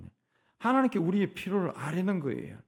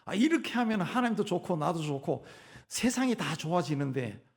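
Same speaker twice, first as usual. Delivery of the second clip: ordinary talk, nothing marked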